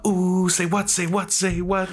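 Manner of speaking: high, drawn-out say what; swaggering entrance. Retold or imitated. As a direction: strut in, calling it out slowly. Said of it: "I'd be like".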